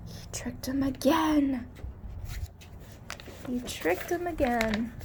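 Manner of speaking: hushed gasp then whisper. Acted. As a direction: share the shock in a secret whisper. Reading to child, shocked.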